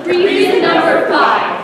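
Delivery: loud, falling announcer call; loud announcement. Acted. Announcing the next reason to the audience, loud. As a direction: boom out the heading.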